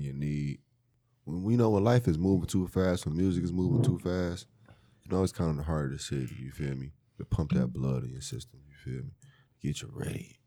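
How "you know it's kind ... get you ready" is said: tipsy and slurring softly. Real, not acted. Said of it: "Is you drunk?".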